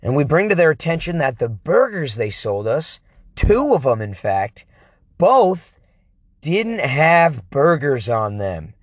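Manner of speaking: ordinary talk, nothing marked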